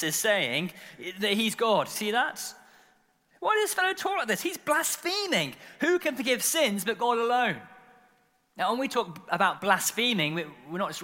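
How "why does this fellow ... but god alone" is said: very high, mock-indignant voice; outraged teachers. Teachers of the law accusing Jesus of blaspheming, high indignation. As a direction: squeak out a scandalised objection. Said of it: "teachers of the law".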